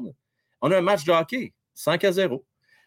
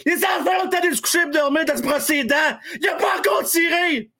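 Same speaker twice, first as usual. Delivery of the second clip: raised, revved-up hockey commentary; building excitement over the goal replay; play-by-play call; 8 semitones higher than usual. Acted.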